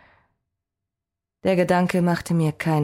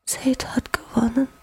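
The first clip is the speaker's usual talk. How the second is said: voice a breath in the wind; 7 semitones higher than usual. Acted. Exhausted goddess whispering her despair to Hathor. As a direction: barely breathe the words of loss. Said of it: "Ihre Stimme war nur ein Hauch im Wind".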